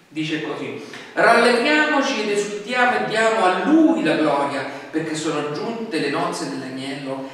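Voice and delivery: usual speaking voice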